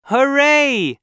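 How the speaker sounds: high-pitched cheer of delight. A narrator's reading. Child celebrates the first lemonade sale, cheering. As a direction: cheer it out happily. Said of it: "I cheered".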